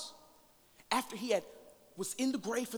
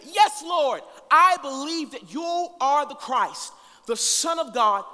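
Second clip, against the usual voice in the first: loud, slow scripture preaching; preaching at full force; 8 semitones higher, 13 dB louder, slower than usual. Acted. Preacher reading Martha's plea to the congregation, full force. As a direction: proclaim each line to the room. Preacher reads the Lazarus passage.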